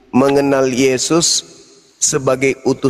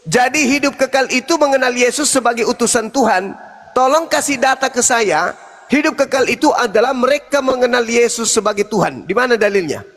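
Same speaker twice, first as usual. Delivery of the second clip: high-pitched, insistent challenge; shouting; 8 semitones higher than usual. Real, not acted. Debater challenging an opponent to produce proof, shouting. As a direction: press the demand in a raised, strained pitch. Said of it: "asal teriak aja dia".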